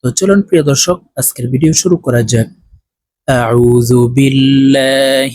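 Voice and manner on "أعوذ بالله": slow, drawn-out chanted recitation; Quran recitation. Shown as a demonstration. Teacher recites slowly for students. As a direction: recite it slowly in melodic tajweed.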